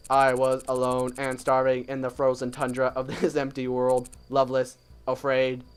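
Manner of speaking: monotone robot lament; melodramatic robot bird. Acted. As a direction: recite your suffering like a self-pitying machine. Bird's melodramatic complaint.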